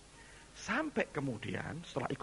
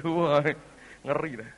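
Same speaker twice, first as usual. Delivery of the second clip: normal talking voice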